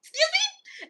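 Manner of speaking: high, incredulous excuse me; shocked disbelief. Retold or imitated. Counselor hearing a kid plan revenge, high shocked disbelief. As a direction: squeak it out in sheer disbelief.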